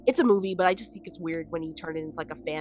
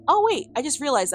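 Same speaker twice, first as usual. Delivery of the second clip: higher, louder burst of surprise; real, startled aside, pitched up; sudden realization; 9 semitones higher, 8 dB louder than usual. Real, not acted. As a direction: interrupt yourself as you notice something. Suddenly notices something on screen.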